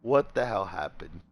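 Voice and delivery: a hint of a stern father; father's low voice. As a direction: play the concerned father low. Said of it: "He's like".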